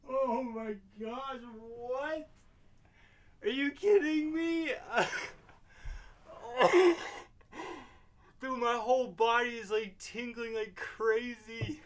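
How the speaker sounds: high, loud, overwhelmed rush; overwhelmed shaking. Real, not acted. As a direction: speak through a shaking body. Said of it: "my body's going numb".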